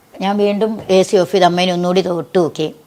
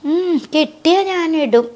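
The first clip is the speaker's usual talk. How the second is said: put-on much higher, slow, frail old woman's voice; imitating her old mother; 10 semitones higher, slower than usual. Mother asking her daughter for the chain, high frail imitation. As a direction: stretch it out like an elderly mother asking sweetly.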